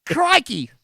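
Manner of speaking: hammed-up Australian accent, high and loud; mock Australian. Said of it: "I'm just saying Australian things now".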